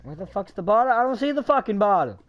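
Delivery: 1 panicked shout. Real, not acted. Panicking sinking in lava.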